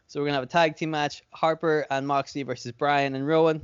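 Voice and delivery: ordinary talk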